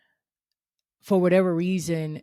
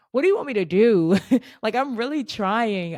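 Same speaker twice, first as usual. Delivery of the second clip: higher, louder, fast cry of frustration; exasperated plea; 5 semitones higher, 5 dB louder than usual. Real, not acted. A fired worker calling out to God, exasperated. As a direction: throw it up at God, breaking into a laugh.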